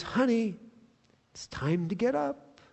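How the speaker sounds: put-on soft, gentle call to a child; hushed and loving, like a parent at the bedside; tender wake-up call. Shown as a demonstration. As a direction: coax a sleepy child awake softly. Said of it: "It's a term of endearment".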